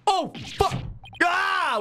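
high, shouted fright; startled shout. Real, not acted. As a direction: yelp in sudden shock. Startled by a gunshot.

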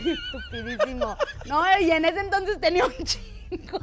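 high giggling self-mockery; laughing. Real, not acted. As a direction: crack up while you say it. Laughs at own joke.

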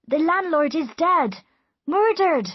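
high, loud cry of alarm. A narrator's reading. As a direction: cry out the shocking news. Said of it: "cried his servant".

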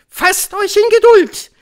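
very high, loud witch voice; piercing high proclamation; commanding Grand High Witch. A narrator's reading. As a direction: shout it as a domineering witch leader.

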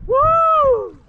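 high joyful whoop; excited cheer. Real, not acted. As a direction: cheer the win. Boat finally working.

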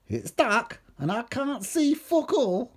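high-pitched comic whine; whingeing. A narrator's reading. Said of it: "Steve began to whinge".

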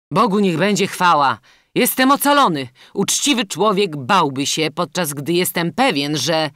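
loud, high cry of relief; jubilant captive prince. A narrator's reading. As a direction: rejoice out loud at being saved. Prince rejoices at being saved.

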